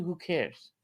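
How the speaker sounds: ordinary talk, nothing marked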